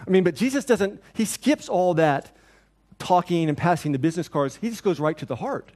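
usual speaking voice

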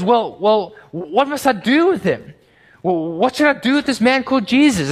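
high, loud, pleading questions; a cornered governor begging for an answer; anxious Pilate. Acted. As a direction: Pilate asking the crowd what to do, high and strained. Pilate torn, asking the crowd.

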